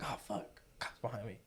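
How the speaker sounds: retold under-the-breath curse; hushed dread. Driver telling friends he spotted a cop, hushed dread. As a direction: drop to a hushed, sinking aside.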